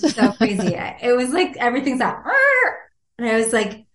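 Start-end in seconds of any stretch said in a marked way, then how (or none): 2.2-2.8 a sudden high yelp of overload; shrill overwhelmed yelp. Retold or imitated. Hearing the news overwhelms her, high yelp. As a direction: squeak out the panic.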